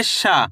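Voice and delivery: शा की आ को लंबा खींचकर बोलें; "शा" का स्वर लंबा, सिखाने के अंदाज़ में; खींचकर उच्चारण. Shown as a demonstration. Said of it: "शा का उच्चारण खींच को रहा है". (शा को खींचकर)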